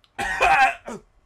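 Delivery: loud choking cough; hacking cough after chugging; harsh coughing fit. Real, not acted.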